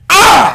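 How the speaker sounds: sudden hammy shriek; overacted scream; bad acting yell. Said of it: "the acting is just like".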